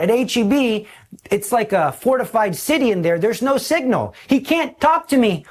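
ordinary talk

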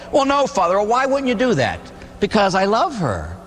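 high, earnest schoolboy replies; light mock student voice. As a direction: answer the teacher eagerly. Students answering priest.

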